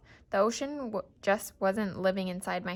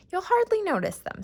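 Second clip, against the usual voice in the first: bright, high and louder, forcing cheer; cheerful; 11 semitones higher, 7 dB louder than usual. A narrator's reading. Mother reassuring her son about new hearing aids, forced cheerful. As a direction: sell it with a big happy lift. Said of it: "trying to be cheerful".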